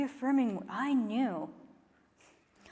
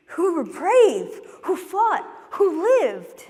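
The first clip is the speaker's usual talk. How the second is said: loud, raised, emphatic triplet; rousing declaration; 5 semitones higher, 6 dB louder than usual. Acted. Poet honouring others like them, rousing and forceful. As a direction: ring out each phrase, high and proud.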